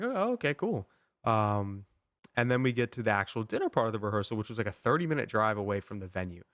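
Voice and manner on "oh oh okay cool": high-pitched, sing-song okay; a forced high squeak of agreement; mock surprised okay. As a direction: play along with forced cheer. Retelling his own awkward reply.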